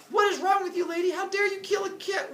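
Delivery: high, loud cartoon outrage; hammed-up high-pitched yell; mock yelling. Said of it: "in the middle of yelling".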